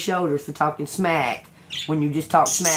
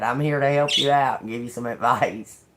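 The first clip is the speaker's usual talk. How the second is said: ordinary talk, nothing marked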